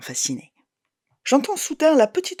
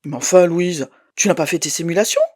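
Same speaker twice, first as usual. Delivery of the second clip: curt, reproachful, slightly louder; a bit stiff; 5 semitones higher, 6 dB louder than usual. Acted. Ex-husband reproaching her, a bit stiff. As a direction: lecture her, a little stiff. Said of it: "un peu raide".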